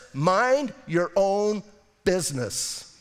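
"mind your own" drawn out slowly, then "business" dropped; slow emphatic. Shown as a demonstration. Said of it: "listen to this".

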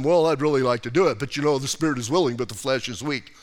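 mocking excuse-maker. Preacher mocking people who excuse their weakness, whiny and fast. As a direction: rattle it off like a lame excuse. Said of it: "I hear people doing that all the time".

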